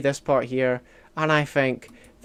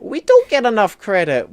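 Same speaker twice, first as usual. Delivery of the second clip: loud, high, indignant agreement; emphatic agreement; 5 semitones higher, 7 dB louder than usual. Real, not acted. Streamer agrees Scots lack credit, emphatic agreement. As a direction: throw it out louder and higher.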